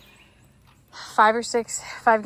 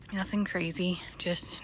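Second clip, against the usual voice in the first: whispering; 6 semitones lower, 11 dB quieter than usual. Real, not acted. Whispered aside about mowing.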